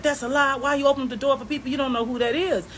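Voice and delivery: snapping back in a heated argument; angry denial. Retold or imitated. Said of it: "break out into a full argument".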